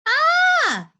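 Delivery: loud, high-pitched scream of fright; startled shriek. A narrator's reading. Henry bumping into Mom outside the room, loud startled shriek. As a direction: shriek as if bumped in the dark. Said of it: "shrieked Henry".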